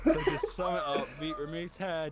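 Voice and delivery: laughing. Real, not acted. Laughing at the punchline.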